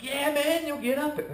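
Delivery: high-pitched buddy encouragement; retold egging on. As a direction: imitate pals talking him into it. Friends egging him on.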